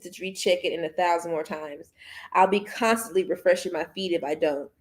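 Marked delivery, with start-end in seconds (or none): none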